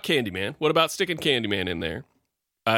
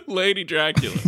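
laughing; 9 semitones higher than usual. Real, not acted. Laughing, renaming character.

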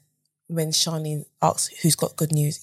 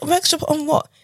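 startled question; 11 semitones higher than usual. Retold or imitated. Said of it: "I'm like".

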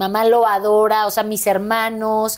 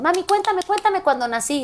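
put-on higher, pleading little-boy voice; imitating her son; 8 semitones higher than usual. Son asking mom about his birth, high childlike plea. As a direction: beg like an eager child at bedtime. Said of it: "Me dice".

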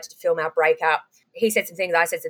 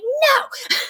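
very high mock scream; retold high-pitched yell; yelled refusal; 3 dB louder than usual. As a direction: holler it back, re-enacting the standoff. Yelling refusal back at producers.

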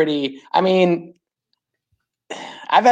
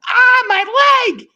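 much higher, louder, slow mock wail of pain; imitated cry of pain; 5 dB louder, much slower than usual. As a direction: ham up the injured friend's cry. Friend hurt under pile.

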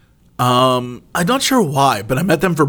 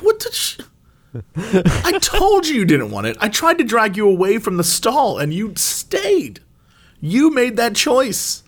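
exasperated scolding; 8 semitones higher than usual. Retold or imitated. Scolding dad over cold pie.

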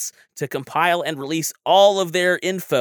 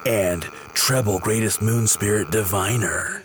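low infomercial announcer voice; hard sell ad; 6 semitones lower than usual. Acted. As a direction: sell it like a cheesy late-night ad. Parody ad with music.

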